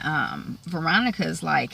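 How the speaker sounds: ordinary delivery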